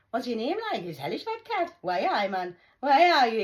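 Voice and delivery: loud, high-swinging Geordie; Geordie accent. Shown as a demonstration. Geordie demonstrating local talk, loud broad Geordie. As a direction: exaggerate the Geordie lilt, loudly. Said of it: "I'm a Geordie".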